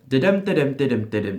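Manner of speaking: "da-dum" with the second beat punched; iambic beat. Shown as a demonstration. Teacher demonstrating rhythm to actor, stressed da-dum. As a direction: tap out "da-dum" weak then strong. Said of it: "So, for example".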